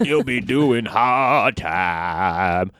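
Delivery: very slow, drawn-out threat; slow menacing tease. Pretend. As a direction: drag it out like a warden. Jokey wordplay on hard times.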